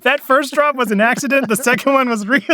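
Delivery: high, loud, through laughter; laughing while talking. Real, not acted. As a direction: talk while cracking up. Laughing after dropping phone.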